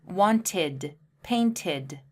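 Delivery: wanted and painted with clear id endings; wanted, painted, each two syllables; careful model words. Shown as a demonstration. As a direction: model them slowly. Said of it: "And listen carefully".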